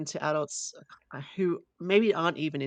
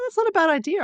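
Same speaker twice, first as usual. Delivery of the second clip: surprised; 14 semitones higher, 8 dB louder than usual. Real, not acted. Pleasantly surprised by the idea.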